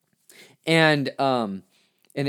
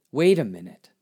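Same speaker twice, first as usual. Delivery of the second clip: sudden realization; 3 dB louder than usual. Retold or imitated. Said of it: "it clicked".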